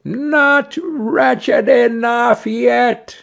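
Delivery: high, slow taunt; storybook bird's vengeful retort; taunting sparrow. A narrator's reading. Sparrow taunts the carter again.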